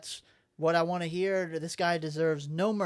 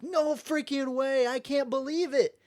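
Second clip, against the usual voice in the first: high, loud, stretched-out amazement; hammed-up awestruck excitement; amazed disbelief; 9 semitones higher, 5 dB louder than usual. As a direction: gush like you can't believe your luck. Acting out an amazed newcomer.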